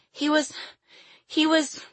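put-on very high child's voice, faltering; choked up. A narrator's reading. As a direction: break off as if a lump fills your throat. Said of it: "A burning lump filled her throat, and she couldn't go on".

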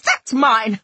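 loud, indignant shout; angry yell. A narrator's reading. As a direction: shout to chase off a thief. Yelling at a child looter.

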